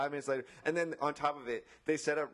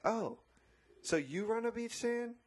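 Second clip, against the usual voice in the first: much higher, mock-incredulous sarcasm; sarcastic; 11 semitones higher than usual. Imagined retort to entitled customer, high sarcastic mockery. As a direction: needle him in a high, put-on voice. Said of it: "I just wanna be like".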